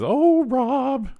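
Donna. Retold or imitated.

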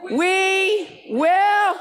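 high, loud, slow shout of "We will win"; stretched triumphant shout; full battle cry. Real, not acted. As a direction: throw it to the rafters. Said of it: "Three's a charm".

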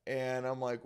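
ordinary talk, nothing marked

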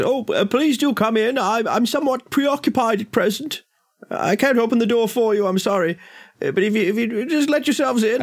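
put-on high, thin old gentleman's voice; old man through a door. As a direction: call out as an old man behind a closed door. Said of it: "Uh, an older gentleman's voice".

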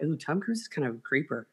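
low, hushed private doubt; inner-thought aside. Retold or imitated. Fan privately having second thoughts about Tom Cruise, inner-thought aside. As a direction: drop the voice right down, as if thinking it. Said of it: "Like, inside, I'm like".

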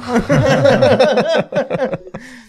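big high burst of laughter; loud laugh. Real, not acted. Laughing at the tree story, loud high laugh. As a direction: crack up loudly.

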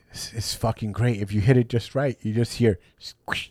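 low gleeful relish. Retold or imitated. Pat describing headbutt.

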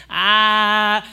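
put-on toddler ah. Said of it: "she picks it up and she goes".

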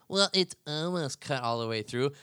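lightly voiced smug arguer, rising on well; a hint of a nitpicking buddy; mock opponent. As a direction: play the know-it-all friend who interrupts.

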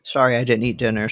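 ordinary talk

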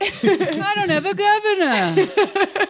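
high, slow, wailing mock cry; mock sobbing. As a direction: whimper it like a hammy breakdown. Mock tragic lament.

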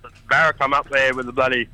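usual speaking voice

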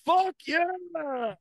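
high, stretched-out mock holler; high-pitched retold cheer; imitated group yell. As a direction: imitate a crowd's rallying cry. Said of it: "And everyone yelled".

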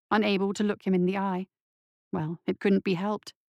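usual speaking voice

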